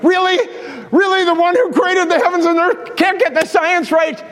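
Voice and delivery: high, disbelieving Really; incredulous. Acted. Preacher scoffing at critics, incredulous and high. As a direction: pitch up high in mock disbelief. Preacher mocking an absurd claim.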